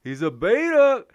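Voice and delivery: high, loud mocking take on Jesse Lee; Jesse Lee impression. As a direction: put on the other guy's voice and sneer the word. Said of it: "Please say".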